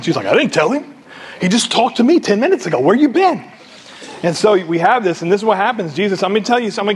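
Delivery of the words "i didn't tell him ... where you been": mildly hammed-up protest, pitched up; acted indignant denial; defensive retort. Spouse denying telling the pastor, mock defensive.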